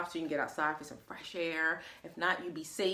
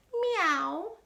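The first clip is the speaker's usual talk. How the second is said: high, rising meow; meowing cat; 10 semitones higher than usual. Acted. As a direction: mew like a cat. Cat sound in story.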